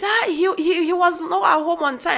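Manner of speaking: a hint of a child in the voice; child's answer.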